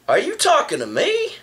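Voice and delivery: high, rising upset question; mock upset challenge. To the guy on the bus, a mildly upset high question. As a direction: push back at him, a little put out. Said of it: "kinda upset, you know, kinda like".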